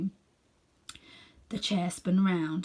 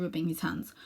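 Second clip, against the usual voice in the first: usual speaking voice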